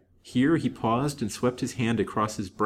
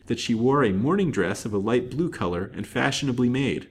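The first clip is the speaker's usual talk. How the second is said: ordinary delivery, nothing marked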